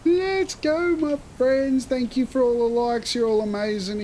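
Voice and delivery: high, excited thanks to chat. Real, not acted. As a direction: cheer the viewers on, pitched high. Thanking stream viewers excitedly.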